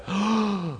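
sharp startled intake of breath; shocked gasp. Acted. Burglar recognizing the judge, gasping in shock. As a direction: gasp as the penny drops.

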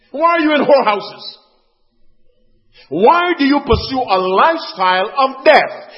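high, loud, impassioned sermon; urgent pulpit exhortation at full volume; full-force preaching. Real, not acted. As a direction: cry out to the congregation, stretching key words.

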